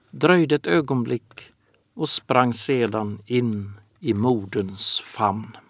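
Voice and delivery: ordinary delivery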